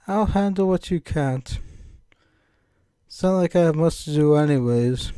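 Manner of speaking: put-on British-accented game girl; Monica. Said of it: "can't shake this British accent".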